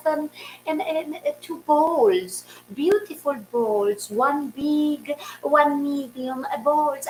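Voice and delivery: put-on high, soft, slow older Eastern European neighbor; soft, high, drawn-out accented voice; Gabriel. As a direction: imitate a hesitant Eastern European lady, high and quiet. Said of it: "She talks like that".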